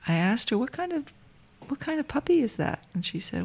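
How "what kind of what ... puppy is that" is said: much higher, soft, sweetly curious question. Retold or imitated. Speaker asking the young woman about her puppy, high and soft. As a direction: ask lightly up high, gently curious.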